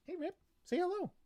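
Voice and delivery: very high, coaxing pet voice; cooing to a puppy. Real, not acted. Urging puppy Rip to greet listeners, high cooing. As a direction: coax gently in a high little voice.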